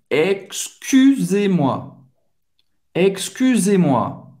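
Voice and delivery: slow "excusez-moi" with pursed [y] in CU; slow model of "excusez-moi", pouted French U. Shown as a demonstration. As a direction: stretch "excusez-moi", I through a U mouth. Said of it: "O U aqui é um som bem francês".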